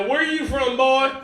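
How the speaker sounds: southern hotel clerk. Acted. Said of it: "the guy hears my accent".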